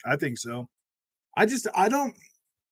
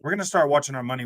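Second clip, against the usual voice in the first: fast telling-off; faster than usual. Retold or imitated. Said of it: "I was like".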